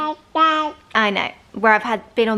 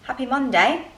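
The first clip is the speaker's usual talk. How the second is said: usual speaking voice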